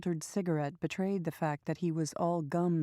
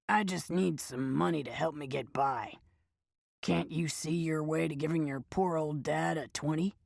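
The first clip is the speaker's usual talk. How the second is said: put-on raspy old man. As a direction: whine for cash like an old panhandler. Old man begs money.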